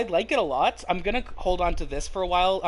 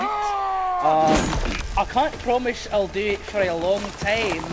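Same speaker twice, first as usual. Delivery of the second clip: put-on Scottish accent; 3 semitones higher than usual. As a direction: put on a hammy Scottish brogue. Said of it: "do your Scottish accent".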